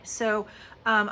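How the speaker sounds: ordinary talk, nothing marked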